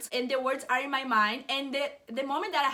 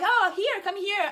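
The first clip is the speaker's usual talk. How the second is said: higher, louder beckoning call; calling over; 7 semitones higher, 4 dB louder than usual. Retold or imitated. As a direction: eagerly wave someone over with the voice. Calling friend over to meet mom.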